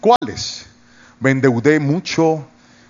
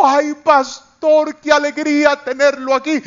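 gushing woman; 10 semitones higher than usual. Retold or imitated. Resort lady greeting the pastor, high gushing voice. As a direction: gush over the pastor. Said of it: "me dijo".

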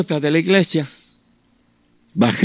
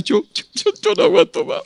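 high, strained, on the edge of tears; a man who can't hold back tears, voice breaking high. Retold or imitated. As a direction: voice cracking up high. Breaking down at the altar call.

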